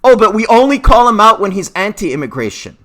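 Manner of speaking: high, loud mock objection; mocking critics. Vlogger aping hypocritical critics, mocking. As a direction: throw the critics' line back scornfully.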